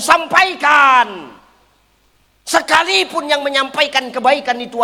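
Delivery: preacher urging the congregation, building to a shout; full-force preaching. Real, not acted. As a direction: drive the message home to the congregation.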